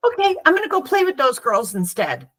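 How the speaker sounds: lightly voiced kid, suddenly cheerful; little boy. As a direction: cheerfully move on like a small boy. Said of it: "And he said".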